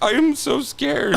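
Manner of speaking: high, slow, whining scared voice; mock-tearful whine. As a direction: draw it out like a frightened child. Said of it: "I was like".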